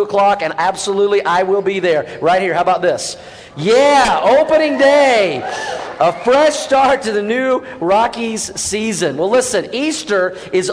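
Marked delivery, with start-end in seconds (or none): 3.6-9.2 excited hype. Acted. Preacher hyping the congregation about opening day, excited and slow.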